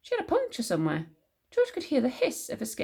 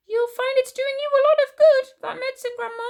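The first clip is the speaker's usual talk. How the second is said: put-on high, loud boyish reassurance; cheery George, encouraging; 8 dB louder than usual. A narrator's reading. George promoting his medicine to Grandma, high and eager. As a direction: pitch it high and upbeat to reassure her.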